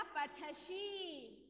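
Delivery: पठसी drawn out very slowly and softly; slow pronunciation demo. Shown as a demonstration. Teacher models पठसी slowly and softly for class. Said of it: "क्या बन जाएगा?".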